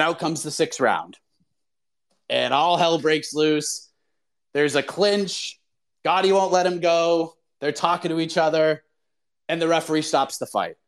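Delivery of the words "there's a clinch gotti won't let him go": usual speaking voice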